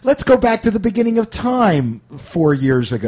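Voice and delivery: high, loud mock-wistful exclamation; mock nostalgia. Host sending up nostalgia for recent years, mock-wistful. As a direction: ham up the longing for ancient history.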